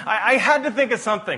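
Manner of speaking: amused laughing aside. Real, not acted. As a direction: giggle it out, pitch up. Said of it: "Okay, that's a bit of a stretch".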